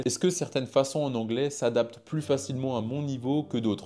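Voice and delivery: ordinary delivery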